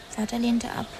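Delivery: usual speaking voice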